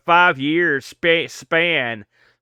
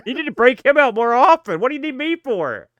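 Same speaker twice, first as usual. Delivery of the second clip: excited; 8 semitones higher than usual. Real, not acted. Mock praise of the puppet.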